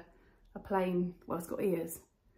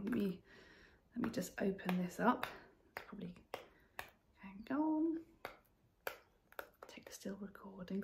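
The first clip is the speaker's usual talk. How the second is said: whispered aside, much softer; 10 dB quieter than usual. Real, not acted. Vlogger struggles with sticky packaging, whispering to herself. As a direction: mutter it under your breath to yourself.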